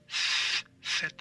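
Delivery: "th" drawn out, then "Z" named the Castilian way; Spain's th for Z. Shown as a demonstration. Teacher demonstrating the letter Z for learners, Castilian th. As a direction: stretch the "th", then name "Z" as in Spain. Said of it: "the Z in Spain is pronounced th".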